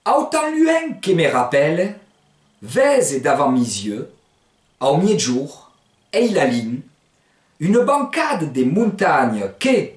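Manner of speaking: slow Provençal reading, pausing after each phrase; lecture lente. Shown as a demonstration. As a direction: read it out slowly, phrase by phrase, for learners. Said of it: "je vais vous lire lentement". (lecture lente en provençal, groupe par groupe)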